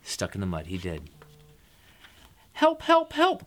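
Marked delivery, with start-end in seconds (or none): 2.5-3.5 high, loud little truck's cry; crying for help. A narrator's reading. Little Blue Truck stuck in mud, high cries for help. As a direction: call out in high, quick bursts. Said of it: "cried the Little Blue Truck".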